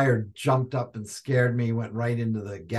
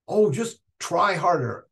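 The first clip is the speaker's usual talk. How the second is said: mock teacher; 6 semitones higher, 3 dB louder than usual. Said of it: "teachers would say".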